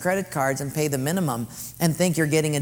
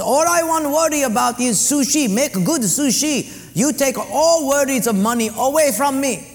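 higher, louder mock Japanese accent boss; broken-English mock Japanese accent, pitched up; Mister Sato; 9 semitones higher, 6 dB louder than usual. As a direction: play the sushi owner in a mock Japanese accent.

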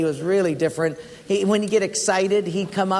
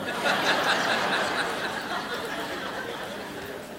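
high airy burst of laughter; high breathy laugh; 6 dB quieter than usual. Real, not acted. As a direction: laugh at his own joke. After praying him dead.

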